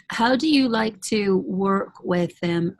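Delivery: ordinary talk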